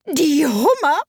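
high, excited cry; excited scream. A narrator's reading. Gryphon cutting in on the Mock Turtle with a leap, screamed. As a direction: burst in with a high-pitched shout. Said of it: "schrie der Greif".

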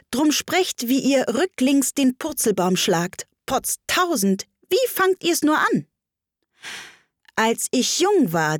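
poem recitation. Acted. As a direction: recite it in a lilting, lifted voice.

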